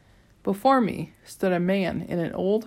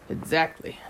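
snapped. A narrator's reading. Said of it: "the aviator snapped".

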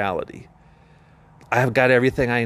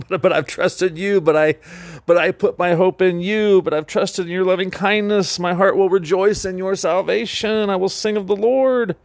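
raised, bright turn to praise, getting higher at the end; joyful declaration; 6 semitones higher than usual. Retold or imitated. David turning from lament to praise, mock exultant. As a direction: lift into triumphant trust. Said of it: "he's like".

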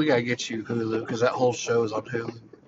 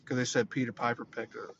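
ordinary talk

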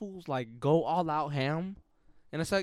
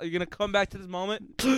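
crying gasp. Acted. Acted dramatic ending.

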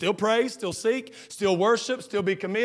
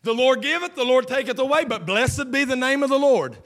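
preached scripture; 6 semitones higher, 7 dB louder than usual. Acted.

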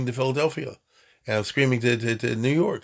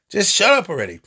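high, louder burst of exasperation; 7 semitones higher, 5 dB louder than usual. Acted. Somebody silencing a room like that, loud voice. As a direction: shout down a noisy room. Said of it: "Somebody with the loudest voice says".

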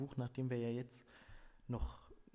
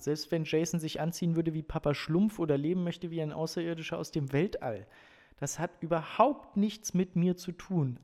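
higher, louder, punchy insistence; emphatic declaration; 6 semitones higher, 8 dB louder than usual. A narrator's reading. Coach tells the family only football matters, emphatic declaration. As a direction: drive each point home with conviction.

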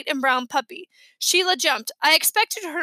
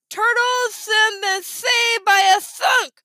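slow, high, loud head-cold speech; stuffed-nose voice; 8 semitones higher, 5 dB louder, much slower than usual. A narrator's reading. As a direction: announce it laboriously through a pinched nose. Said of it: "they had the worst colds".

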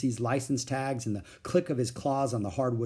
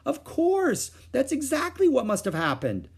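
sudden realisation; 4 dB louder than usual. A narrator's reading. Mother's realisation.

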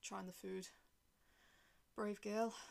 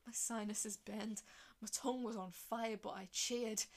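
ordinary delivery, nothing marked

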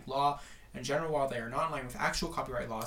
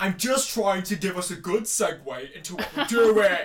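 louder, higher protest; loud defensive; 6 semitones higher, 10 dB louder than usual. Real, not acted. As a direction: push back loudly, defending herself. Defensive outburst after being interrupted.

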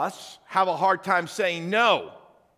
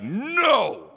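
empowered no; 6 semitones higher, 4 dB louder than usual. Shown as a demonstration. Pastor showing how Americans say no, forceful. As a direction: say no like you feel empowered. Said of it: "like that".

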